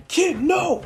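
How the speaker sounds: desperate shout to stop him; heroes yelling. Retold or imitated. Heroes yell at the kid.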